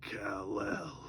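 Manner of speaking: low creepy alien breath; creepy villain voice. Acted. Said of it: "Brainiac's creepy voice going".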